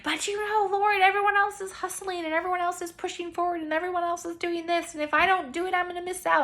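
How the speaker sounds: high, loud whiny protest; whiny pleading to God. Retold or imitated. She complains to God in a high, loud whine. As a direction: plead like an anxious kid falling behind. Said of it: "And I'm like".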